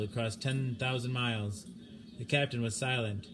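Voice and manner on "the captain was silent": ordinary delivery, nothing marked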